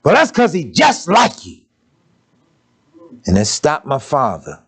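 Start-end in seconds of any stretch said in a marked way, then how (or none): 0.0-1.6 grandmother's retort. Retold or imitated. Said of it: "She said to my father".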